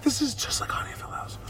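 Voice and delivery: excited whisper, hushed and quick. Retold or imitated. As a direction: lean in and whisper it fast. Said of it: "Remember, I was like".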